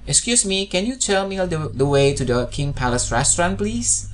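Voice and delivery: polite question rising at the end. Shown as a demonstration. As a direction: pitch climbs on please, ending high. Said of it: "nada suaranya juga agak naik ya".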